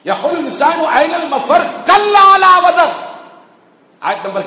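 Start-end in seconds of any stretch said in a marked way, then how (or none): 0.1-2.9 slow, high, loud Quranic recitation; ringing recitation. Acted. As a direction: build the verse to a long, loud ringing peak. Preacher recites a warning verse.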